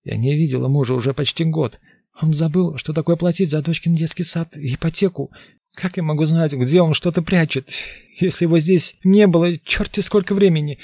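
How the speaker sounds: high, loud frantic protest; desperate pleading. Acted. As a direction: plead in rising panic.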